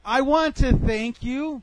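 loud, high, slow thanks; pointedly grand thanks; deliberate parting shot. Retold or imitated. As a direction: draw out each word to set up the jab. Retold parting shot, set up.

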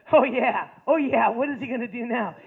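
loud mock hooting from classmates; jeering cheer. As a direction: egg him on like the boys in class. Boys heckle him in retelling.

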